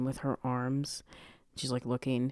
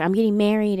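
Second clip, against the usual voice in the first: higher, louder mock panic; freaking out; 7 semitones higher, 8 dB louder than usual. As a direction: blurt it in imitated distress. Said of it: "freaking out on the side of the street".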